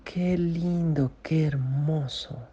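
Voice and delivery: high, slow, breathy-free gasp of awe "¡Ah! ¡Qué lindo, qué hermoso!"; high slow cry of delighted wonder; amazed wonder. Shown as a demonstration. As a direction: show how it sounds to be amazed. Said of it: "así como cuando uno se asombra".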